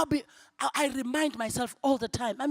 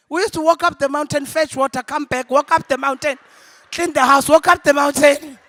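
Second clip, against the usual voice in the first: louder and louder, building outrage; indignant rant; 5 semitones higher, 11 dB louder than usual. Retold or imitated. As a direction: hammer out every chore. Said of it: "I'm like".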